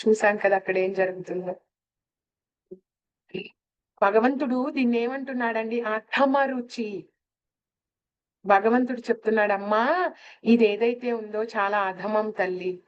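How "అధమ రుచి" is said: slow emphasis. Shown as a demonstration. Teacher stresses God's term.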